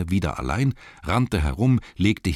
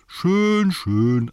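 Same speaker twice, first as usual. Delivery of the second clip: high, louder sarcastic schön, schön; mocking agreement; 13 semitones higher, 6 dB louder than usual. Elves humouring the grumpy cellarman, mocking. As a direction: drawl it out mockingly. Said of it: "antworteten sie".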